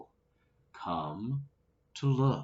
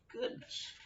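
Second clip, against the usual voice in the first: a breathy whisper of amazement; reader's whispered aside; whispered goodness; 11 dB quieter than usual. Real, not acted. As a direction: whisper it in wonder.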